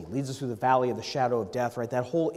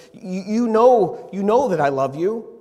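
louder and higher, slow and strained on the first words; pained plea; 7 semitones higher, 10 dB louder than usual. Retold or imitated. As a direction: cry it out, hurt and exasperated. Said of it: "I can hear the pain in Peter's voice".

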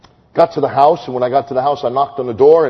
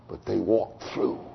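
hushed; 5 semitones lower, 10 dB quieter than usual. Acted. Hushed sermon line after climax.

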